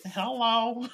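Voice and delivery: tiny high-pitched greeting; high, drawn-out baby voice; baby creature voice. Retold or imitated. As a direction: squeak it like a cute little creature. Acting Baby Yoda waving back.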